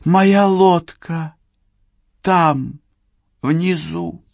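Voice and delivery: put-on high, slow drunk woman voice. A narrator's reading. Drunk Cheryl points at boat.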